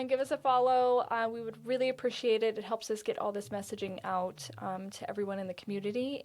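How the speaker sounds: usual speaking voice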